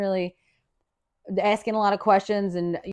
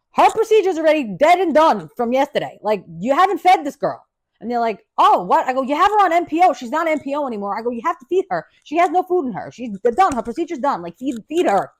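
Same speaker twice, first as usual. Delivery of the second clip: mock angry scolding; 5 semitones higher, 4 dB louder than usual. Scolding nurses about feeding.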